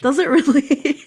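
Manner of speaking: mock disbelief that breaks into laughter; laughing disbelief. Podcast guest retelling her reaction to her AI voice, laughing. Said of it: "And I'm like".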